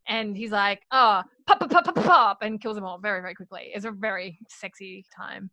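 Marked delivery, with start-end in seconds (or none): 0.9-2.4 high, loud pop pop gun noises; playful gunshot sounds, higher and louder; mock gunshots. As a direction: fire off each pop like a pistol. Imitating gunshots in retelling.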